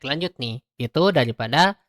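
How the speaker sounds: ordinary delivery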